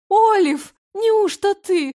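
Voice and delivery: high, slow, astonished cry; surprised exclamation. Acted. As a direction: gasp it out in disbelief. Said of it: "воскликнула женщина".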